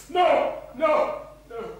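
ordinary delivery

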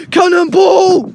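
loud drawn-out cannonball shout; cannonball yell. Real, not acted. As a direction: yell it as you jump.

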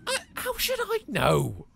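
high, slow mock crying whine. Answering the object he talks to, in a mock tearful whine. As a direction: whimper it like a sulking child.

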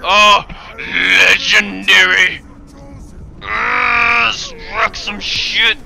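high, loud, stretched-out bellow; drawn-out shout. Real, not acted. Player reacting to a legendary foe, drawn-out shouting. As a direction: shout it slowly, stretching every word.

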